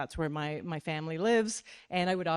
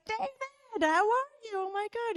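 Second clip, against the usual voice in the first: much higher, gushing; breathless high delight; squealing surprise. Retold or imitated. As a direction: squeal at the old flame's call. Surprised call from ex.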